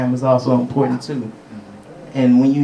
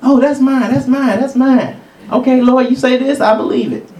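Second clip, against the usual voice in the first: higher, eager claiming; childlike eagerness; 7 semitones higher than usual. Acted. Childlike believer accepting God's word, eager higher voice. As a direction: grab it with a child's faith. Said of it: "that childlike faith can take anything".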